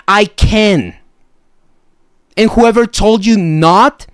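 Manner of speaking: usual speaking voice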